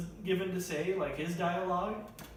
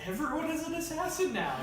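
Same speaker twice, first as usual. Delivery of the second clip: high-pitched; 11 semitones higher than usual. Pretend. Mock reveal, voice jumps high.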